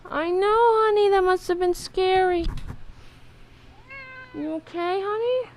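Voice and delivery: high, sing-song cooing to the cat. Real, not acted. Cooing to her hurt cat.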